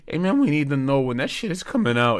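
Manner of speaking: high, loud voice of a demanding fan; pestering fan. Acted. Fans out in the cold want news, hammed-up hollering. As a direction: call out urgently, hammed-up.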